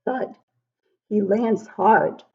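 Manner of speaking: ordinary delivery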